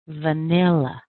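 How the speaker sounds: "Vanilla" with a long, strong middle syllable; "Vanilla", middle syllable stretched and punched, weak ends; stress on second syllable. Shown as a demonstration. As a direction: lean on the NIL of "Vanilla". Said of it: "It's right on the second syllable".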